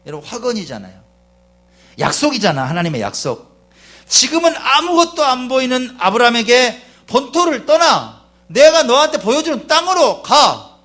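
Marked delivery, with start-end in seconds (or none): 2.0-10.8 loud, high proclamation of God's promise; shouted, emphatic revival sermon; impassioned preaching. Real, not acted. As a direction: thunder out a divine command from the pulpit. Preaches, voicing God's command to Abraham.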